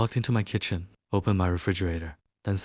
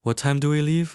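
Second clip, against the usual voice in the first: higher and louder, suddenly keen; eager agreement; 6 semitones higher, 4 dB louder than usual. Acted. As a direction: say yes with a jump of excitement. Agrees to the fake date.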